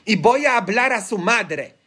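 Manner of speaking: re-enacted stern warning, rising in pitch; retold warning to prisoners. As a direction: threaten to tell their mothers, scolding. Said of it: "les decía".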